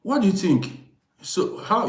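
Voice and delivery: ordinary talk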